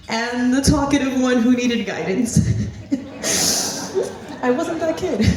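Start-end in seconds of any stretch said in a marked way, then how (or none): 2.4-4.4 a quick, airless chuckle; a real soft laugh; breathy laugh. Real, not acted. Joking about being talkative. 4.4-5.3 higher and laughing through the words; joking denial with a laugh. Real, not acted. Joking about being talkative.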